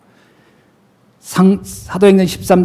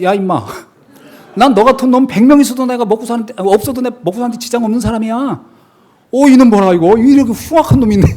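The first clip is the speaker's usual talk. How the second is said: louder, higher mock scolding with a laugh; retold scolding; 5 semitones higher, 4 dB louder than usual. Pastor imitating scolding a first grader, amused mock anger. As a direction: replay telling off the cheeky kid.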